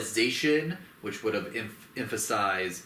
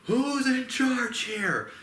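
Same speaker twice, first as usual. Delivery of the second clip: high, very slow squeaky demand; mock ape demand; 4 dB louder, much slower than usual. Kong demands the leader, in a high, slow squeaky voice. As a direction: drag it out way up high like a cartoon ape. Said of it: "and it's like".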